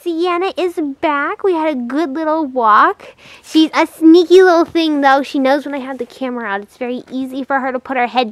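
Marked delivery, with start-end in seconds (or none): none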